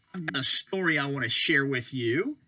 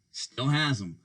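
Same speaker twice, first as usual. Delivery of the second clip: plain reading voice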